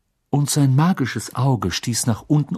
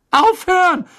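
high, loud screech of protest; shriek; 7 dB louder than usual. A narrator's reading. Pupil begging the teacher to stop, piercing shriek. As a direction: shriek it at the top of the voice. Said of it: "kreischte Hermine".

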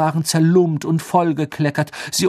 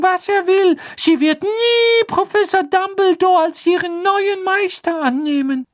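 hoch, langsam und gequetscht; Dobby klagt über Winky; Hauselfenstimme; 5 dB louder than usual. A narrator's reading. Dobby voiced by narrator. (hohe, klagende Elfenstimme)

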